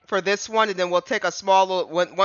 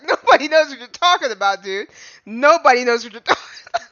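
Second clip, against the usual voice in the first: higher, louder exasperated judge; hammed-up impatient judge voice; mock judge; 6 semitones higher, 5 dB louder than usual. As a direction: play the fed-up judge, laughing at the end. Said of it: "the judge was like".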